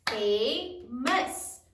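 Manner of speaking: "Famous" as two separate, drawn-out beats; broken into syllables. Shown as a demonstration. Teacher counts syllables for the class, "Famous" broken up. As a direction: split "Famous" in two, lifting the end. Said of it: "How many syllables are there".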